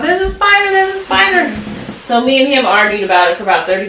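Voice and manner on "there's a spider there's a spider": high-pitched mock freak-out; retold panic, pitched way up; imitating spider panic. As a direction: mimic a child panicking over a spider. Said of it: "he came out tripping, talking about".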